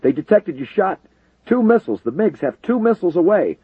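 high, loud radio alarm; alarmed shout. A narrator's reading. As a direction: yell the warning urgently. Controller warns over radio.

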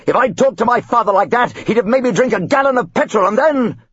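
hammed-up enraged father; raging father. Father chasing his son, raging.

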